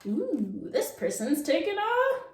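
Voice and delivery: normal talking voice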